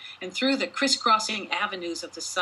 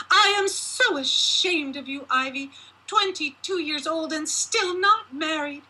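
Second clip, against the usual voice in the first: high, loud scolding giantess; shrill-pitched mother shaming her daughter; raging mother; 9 semitones higher, 3 dB louder than usual. A narrator's reading. Mother berating her unmarried daughter, in a high raging voice. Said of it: "Her expression was twisted with rage".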